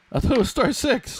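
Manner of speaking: high, loud exasperated cry; frustrated groaning. Real, not acted. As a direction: wail it out in disbelief. Frustrated, muted in the meeting.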